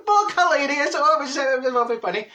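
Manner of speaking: laughing. Real, not acted. Laughs mid-sentence.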